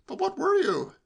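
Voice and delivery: high-pitched insistence; pestering nephew. A narrator's reading. Nephew refusing to drop it, pestering. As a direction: press again in a high voice.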